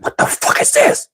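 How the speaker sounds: voiceless, high squeak of horror; hushed, strangled acted shock; shocked disbelief. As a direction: gasp it out in mock horror. Retold shock at bloody doctor.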